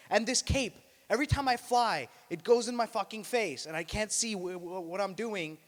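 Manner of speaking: loud, high exasperated rant; ranting Superman. Acted. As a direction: vent like a fed-up hero.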